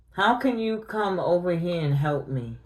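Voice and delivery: usual speaking voice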